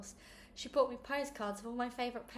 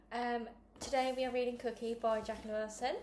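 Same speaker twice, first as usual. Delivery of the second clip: ordinary delivery, nothing marked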